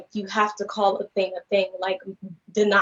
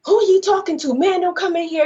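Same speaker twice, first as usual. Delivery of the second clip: high, louder mock heckling; audience going off; 9 semitones higher, 4 dB louder than usual. Moviegoers objecting to the noisy man, imitated going off. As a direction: imitate indignant moviegoers calling out. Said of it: "Everybody in the movie theater is going off".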